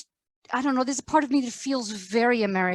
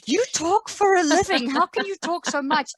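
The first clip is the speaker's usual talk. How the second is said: lightly voiced Rich, higher and amused; a hint of Rich, pitched up in disbelief; quoting Rich; 7 semitones higher than usual. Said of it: "and Rich said".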